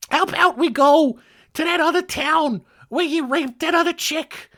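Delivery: hammed-up, very high-pitched wife voice; Nancy impression. Wife agreeing to her husband's plan, mock high-pitched voice. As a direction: squeak it out as a mock eager accomplice, way up high. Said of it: "Nancy Blackmouth over here is just like".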